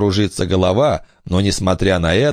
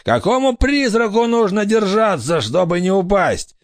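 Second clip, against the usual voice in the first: doubting pirate; 12 semitones higher than usual. A narrator's reading. Scared pirate challenges the ghosts.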